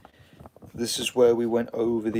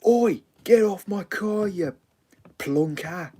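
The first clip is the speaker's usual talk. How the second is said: put-on much higher, louder furious old man; angry old bloke; 11 semitones higher, 4 dB louder than usual. Old driver yelling at Peter, high angry shout. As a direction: yell him off the car like a cross English geezer. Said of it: "that's how we say it".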